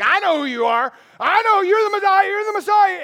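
high, loud screeching demon. Acted. Demon shrieking at Jesus in recognition, high and loud. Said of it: "The demon's like".

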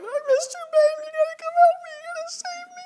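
put-on high, whiny child pleading for help; mock-crying four-year-old begging the teacher; scared crying kid. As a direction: plead like a panicked little kid. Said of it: "they start crying".

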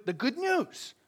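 the good news lifted high in a different voice; a hint of a pulpit announcement; announced term. Shown as a demonstration. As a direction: present the good news like a title. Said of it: "that's what we'd call it".

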